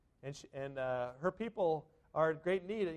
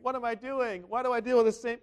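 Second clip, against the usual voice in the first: louder and higher, exasperated self-talk; frustrated outburst; 6 semitones higher, 6 dB louder than usual. Retold or imitated. Preacher acting out his own bad day, exasperated. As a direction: vent the frustration, pitched up and loud.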